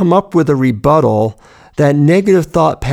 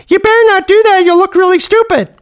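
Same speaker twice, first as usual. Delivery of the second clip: very high, squeaky cartoon mouse voice; Mickey Mouse; 3 dB louder, faster than usual. Inner critic turned cartoon, very high squeaky mouse voice. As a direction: squeak it out in a sky-high falsetto. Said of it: "has a very high, squeaky voice".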